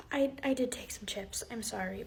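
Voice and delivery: very soft and low; hushed, guilty mumble; sheepish confession. Acted. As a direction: admit it under your breath. Caught student confesses.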